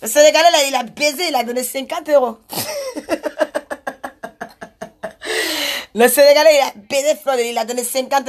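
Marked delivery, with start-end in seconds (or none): 2.4-5.9 long, staccato fit of laughter. Real, not acted. Laughing at own gossip, long real laugh. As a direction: crack up and keep laughing.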